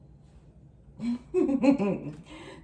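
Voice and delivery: a burst of real laughter, loud. Real, not acted. Reader cracks up at her own joke, loud laugh.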